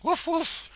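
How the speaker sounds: high playful woof woof; high mock dog bark. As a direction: yap out two high woofs like a small dog. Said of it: "bark like a dog for me".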